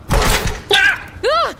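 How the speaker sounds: sharp high startled scream, twice; frightened yelp at a sudden shape. Acted. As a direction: jump out of your skin and yell. Startled by hidden robot.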